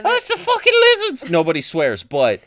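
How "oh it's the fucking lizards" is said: high, loud caricature Aussie; shouted mock-Australian, pitched way up; over-the-top Australian. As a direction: yell it in a hammed-up Australian accent. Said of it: "just over the top Australian".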